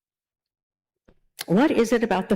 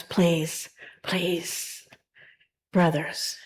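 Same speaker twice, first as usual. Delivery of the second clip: gentle, hushed plea, fading softer; calm them down softly, almost pleading; a peacemaker quietly begging two brawling men to stop; 5 dB quieter, much slower than usual. Retold or imitated. Peacemaker calming two fighting men.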